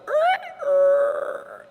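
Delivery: very high, stretched mock creak; imitated creaking sound. Circle of guys opening up, very high mock creaking noise. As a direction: squeak it like a door swinging open. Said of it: "the circle goes".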